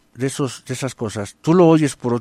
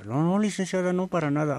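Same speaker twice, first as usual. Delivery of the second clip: meek employee; 4 semitones higher, 6 dB quieter than usual. Acted. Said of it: "la muchacha se queda".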